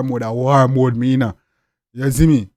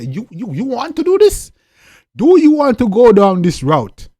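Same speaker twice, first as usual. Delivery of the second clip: loud, pointed challenge, pushing each word; loud and high, daring him to commit; 6 semitones higher, 7 dB louder than usual. Retold or imitated. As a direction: demand an answer from the rival. Checking the rival means war.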